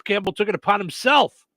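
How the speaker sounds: usual speaking voice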